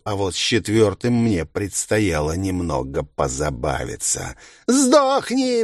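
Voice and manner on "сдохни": high, loud shriek. A narrator's reading. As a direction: screech it in rage. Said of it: "верещал ублюдок".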